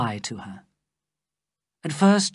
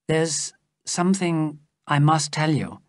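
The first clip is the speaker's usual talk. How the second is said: usual speaking voice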